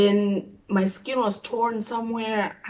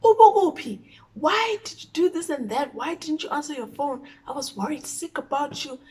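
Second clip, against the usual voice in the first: higher, mock angry mother yelling; yelling mom; 6 semitones higher than usual. Mom scolding her child for not answering, mildly yelling. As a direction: play the worried, furious mom. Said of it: "she's yelling".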